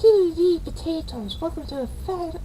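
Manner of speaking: very high, loud cartoonish squeal; squeaky high intro voice. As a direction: goof around in a squeaky voice. Silly high-pitched show intro.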